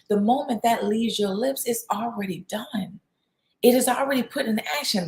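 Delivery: ordinary delivery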